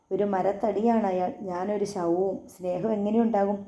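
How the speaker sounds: ordinary delivery, nothing marked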